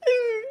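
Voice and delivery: squeaky-high loud mimicked "ooh"; loud, very high echoing "ooh"; high-pitched toy "ooh". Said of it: "a higher pitched voice".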